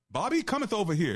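high, loud mock-archaic call across the room; mock King James English. Shown as a demonstration. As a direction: ham up the stiff old Bible wording. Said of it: "we don't talk like that".

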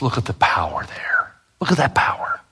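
hushed, reverent whisper; awed whisper. Real, not acted. Preacher to congregation, awed whisper. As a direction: breathe it out in wonder. Awed aside about Jesus's power.

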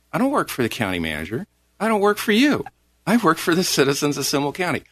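retold argument, standing your ground; defiant retort. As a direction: push back indignantly, building after the first sentence. Retelling own argument with commissioner.

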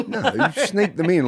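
slow, amused explanation; amused, slow clarification to co-host; laughing correction. Real, not acted. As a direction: laugh while setting it straight. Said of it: "correcting a co-host, laughing".

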